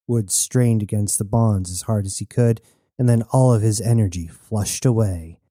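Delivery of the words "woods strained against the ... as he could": ordinary delivery, nothing marked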